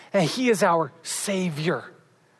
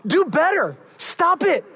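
God yelling at us; 8 semitones higher than usual. Pretend. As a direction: snap it like an angry God scolding a child. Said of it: "We want God to, to yell at us, say".

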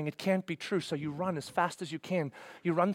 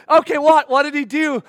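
loud, high excited demand; eager questioning; 9 semitones higher, 14 dB louder than usual. Acted. Believer to a sprinting neighbor, loudly eager for news. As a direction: press for the news, bursting.

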